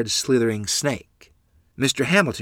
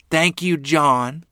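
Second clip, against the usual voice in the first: grateful thanks; 6 semitones higher, 4 dB louder, much slower than usual. Acted. Mother thanking the rescuer.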